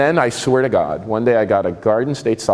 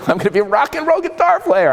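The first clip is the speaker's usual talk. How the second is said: excited. Retold or imitated. Boasting kid's plan.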